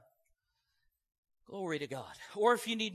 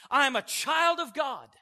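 louder, firm proclamation; bold declaration; 5 semitones higher, 7 dB louder, slower than usual. Shown as a demonstration. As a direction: stand up and declare it boldly. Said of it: "You stand up in the middle of it and say".

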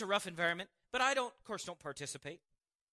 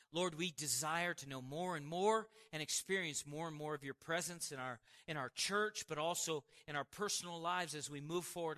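hushed thanksgiving prayer voice; 4 semitones lower than usual. Acted. Closing prayer.

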